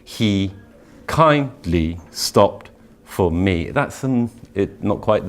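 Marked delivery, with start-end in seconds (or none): 0.0-3.7 "kindly stopped for me" drawn out slowly; slow stressed reading. Shown as a demonstration. Teacher showing the meter of the line slowly. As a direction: stretch it, beat by beat. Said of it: "our emphasis does go slightly different".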